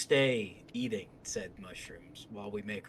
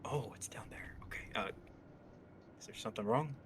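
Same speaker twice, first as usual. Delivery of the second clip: whispering; 9 dB quieter than usual. Acted. Whispering to the creature.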